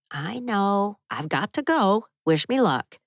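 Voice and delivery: usual speaking voice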